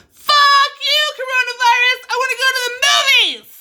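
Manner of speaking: very high, very loud yell; primal scream. Shown as a demonstration. Mom yelling out her anger at the pandemic, screaming. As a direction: let it rip from the lungs. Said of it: "the primal scream that we did".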